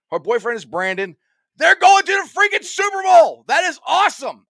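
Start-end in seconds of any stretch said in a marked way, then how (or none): 1.6-3.4 high, loud thrilled announcement; excited shout. Real, not acted. Host announces Super Bowl winners. 3.5-4.5 thrilled. Real, not acted. Host thrilled about winners.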